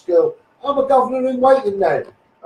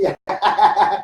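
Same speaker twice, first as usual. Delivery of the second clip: real burst of laughter; a run of open chuckles; hearty laugh. Real, not acted. Laughs after telling of tip-off.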